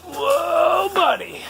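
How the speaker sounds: wow at the first sight; amazed whoa. Real, not acted. First look inside the boat.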